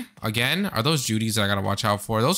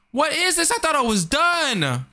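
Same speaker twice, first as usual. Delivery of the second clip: high disbelief; 14 semitones higher than usual. Real, not acted. Frustrated at unexpected dead end.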